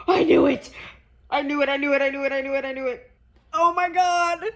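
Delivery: loud, high outburst of vindication; excited shouting. Real, not acted. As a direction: shout it in triumphant shock. Reader's prediction comes true.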